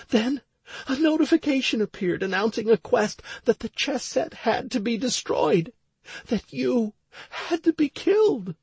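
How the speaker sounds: tearful, high-pitched woman's voice; Stacy. A narrator's reading. Crying wife explaining her panic to her husband, tearful. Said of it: "still crying her eyes out".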